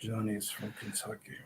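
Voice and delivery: put-on British-Kentucky accent, low and hushed; Kentucky British accent, again. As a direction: murmur it in a lilting mixed accent. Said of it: "Kentucky British accent".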